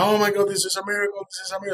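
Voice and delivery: high, fast retold gasp of wonder; excited nun. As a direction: mimic her amazed cry of a miracle. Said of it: "she's like".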